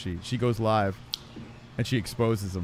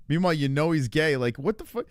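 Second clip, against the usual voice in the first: ordinary talk, nothing marked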